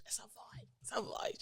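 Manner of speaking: fading to a whisper. Shown as a demonstration. Singer showing how her voice disappeared, whispered and fading. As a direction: let the voice vanish into a whisper.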